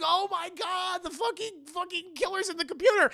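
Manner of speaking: high, freaked-out scream-talk; hammed-up high-pitched panic; panicked Sydney. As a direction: play a girl panicking wildly. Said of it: "And she's like".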